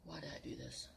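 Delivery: soft, falling rueful question; rueful mutter. Real, not acted. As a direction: ask it under your breath. Regretting the icy plunge.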